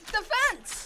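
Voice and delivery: high, loud burst; panicked exclamation. Acted. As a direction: blurt it out while fleeing. Fleeing boy spots escape.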